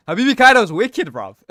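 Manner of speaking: loud, high hype call; excited shout. Real, not acted. Hyping a viewer's profile picture.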